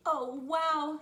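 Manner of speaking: loud, high burst of amazement; storybook kid's big wow; amazed. Acted. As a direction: exclaim it, wowed.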